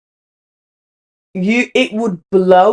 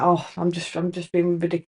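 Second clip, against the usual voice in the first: softer, mock self-dismissive mumble; 5 semitones lower, 11 dB quieter than usual. Doubting herself about her feelings, softly dismissive. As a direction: brush it off quietly as if it's nothing. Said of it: "for it to be like".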